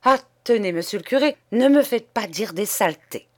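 sputtering in scandalized agitation; violently agitated. Acted. Nun describing the indecent statue to the priest, agitated. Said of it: "en proie à une agitation violente".

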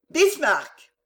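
high, loud call to the dog. Acted. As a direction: call the dog's name sharply. On crie le nom au chien. (appel fort au chien)

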